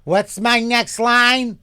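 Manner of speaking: high, loud, drawn-out theatrical call; slow mock-theatrical cry; hammed-up actress. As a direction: call for the line like a mock diva. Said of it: "She was a Tony Award-winning fucking actress".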